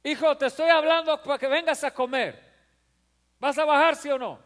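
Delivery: high, loud, firmer call with an edge; losing patience with the kid; impatient mother. Retold or imitated. Mother losing patience with her son who won't come. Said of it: "ya el tono de voz es diferente".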